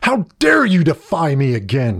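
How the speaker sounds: teacher shouting down defiance; outraged shout. A narrator's reading. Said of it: "shouted Mister Smith".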